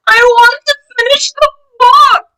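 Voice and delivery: loud, high, pleading cry. A narrator's reading. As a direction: wail it like a desperate little dragon. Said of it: "cried Duncan".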